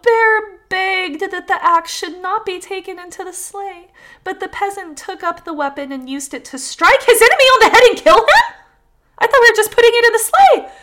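high wail building to loud disbelief; dismayed, voice climbing high and loud; distressed outcry. Real, not acted. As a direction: read on in rising, upset protest. Upset by the bear's killing.

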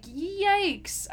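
mock alarm. Retold reaction to dark dialogue.

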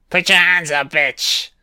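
hammed-up cop demand; mock police order, lightly voiced. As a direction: bark it like a bad movie cop. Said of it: "She attempts fucking acting".

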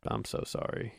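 whispered, sheepish apology; soft unvoiced sorry to the mic; embarrassed whisper. Real, not acted. Embarrassed apology after farting.

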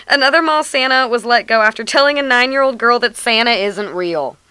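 ordinary talk

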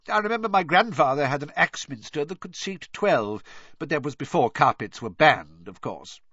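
lightly voiced stern bureaucrat; stern official.

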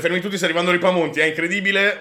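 fast, loud, high announcement; mock announcer. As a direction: announce a VIP arrival breathlessly.

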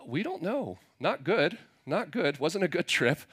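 high-pitched, hesitant mock reply; sheepish sons. Sons sheepishly report to their father.

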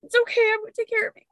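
high soothing coo; mock tender comfort. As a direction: coo it to a hurt pet. Comforting dog.

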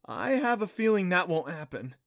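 a touch of foreboding; grim. A narrator's reading. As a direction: say it with grim doubt. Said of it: "Adam said grimly".

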